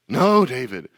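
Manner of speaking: loud, firm no David; officer Bonnie insisting, out loud; firm insistence. Pretend.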